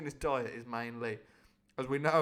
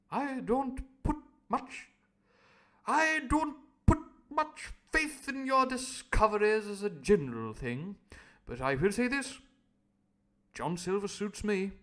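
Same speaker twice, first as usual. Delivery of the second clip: posh doctor; 7 semitones higher, 7 dB louder than usual. Acted. Doctor's line voiced posh.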